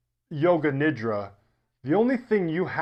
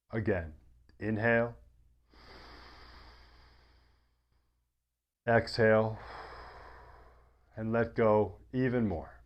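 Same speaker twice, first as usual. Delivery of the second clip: guided meditation; 4 semitones lower than usual. Acted. Yoga teacher settling listeners into deep rest, guided meditation. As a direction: ease them gently into rest, low and unhurried.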